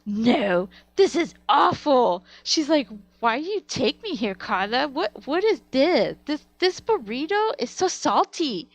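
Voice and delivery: loud, high, drawn-out mock complaint; hammed-up disgusted mother imitation. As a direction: wail it like an outraged little mom. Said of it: "My mom's like".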